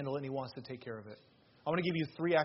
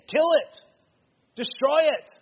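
higher, loud preacher's shout; shouted command; 10 semitones higher, 8 dB louder than usual. Real, not acted. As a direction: shout it out at full force. Preacher urges destroying temptation.

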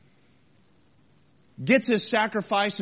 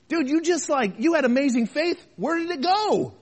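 incredulous; 6 semitones higher than usual. Acted. Challenging a discouraged Elijah.

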